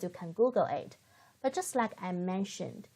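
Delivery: usual speaking voice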